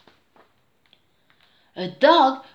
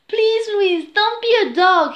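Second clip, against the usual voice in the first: high, urgent plea; wishing; 11 semitones higher, 7 dB louder than usual. A narrator's reading. Jake wishing his sister back from being a dog, pleading. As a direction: wish hard out loud. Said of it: "he wished".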